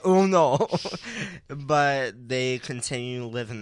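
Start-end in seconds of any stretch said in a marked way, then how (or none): 0.0-0.7 loud, high mock wail; mock dismay. Mock alarm at the story.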